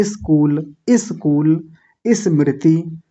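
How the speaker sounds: स्कूल and स्मृति each with an i in front; mispronounced s clusters. Retold or imitated. Teacher repeating a wrong habit, स्कूल and स्मृति with leading i. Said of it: "तो वैसा नहीं बोलेंगे".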